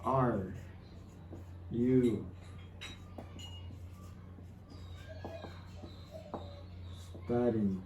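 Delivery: "Are", "you", "studying" said one word at a time with long pauses; slow word by word. Shown as a demonstration. Teacher dictating an example question to students, slow and broken. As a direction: spell out "Are you studying?" slowly for learners.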